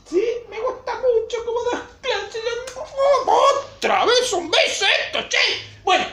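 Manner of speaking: very high squeaky voice; playful little character. Acted. Supervisor telling the teacher she likes her class, high squeaky delight. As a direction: squeal it like an excited little puppet.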